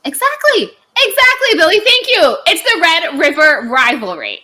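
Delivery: high, loud vindicated exclamation; emphatic agreement. Real, not acted. Thanking Billy for backing her, loud high excitement. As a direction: shout it like you've been proven right.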